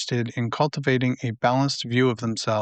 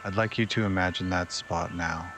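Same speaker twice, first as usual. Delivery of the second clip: guided meditation; 3 semitones lower, 6 dB quieter than usual. Acted. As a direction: ease the listener toward sleep, unhurried and hushed. Leads a nighttime meditation.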